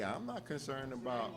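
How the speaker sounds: unbothered, even reply to the Senate; calm. Retold or imitated. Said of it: "he would just calmly say".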